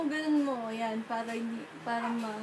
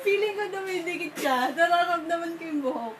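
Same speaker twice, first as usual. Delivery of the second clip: laughing; 5 semitones higher than usual. Real, not acted. Mascara sticking, laughing fit.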